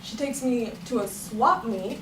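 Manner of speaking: ordinary talk, nothing marked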